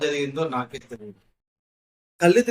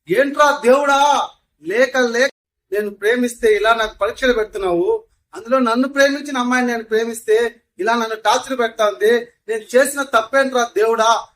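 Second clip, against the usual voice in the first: yelling at God; 6 semitones higher, 7 dB louder than usual. Retold or imitated. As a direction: shout your despair up at the sky. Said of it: "గట్టిగా అరిచాను".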